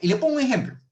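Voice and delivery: emphatic announcement. Real, not acted. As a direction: call it out to grab the audience. Announces an example, then repeats. (anunciando el ejemplo en voz alta y aguda)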